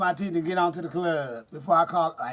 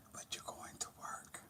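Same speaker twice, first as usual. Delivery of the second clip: hushed, firm whisper; whispered warning; 23 dB quieter than usual. Pretend. Aunt telling her grown nephew he must work, whispering. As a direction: whisper it like a secret rule.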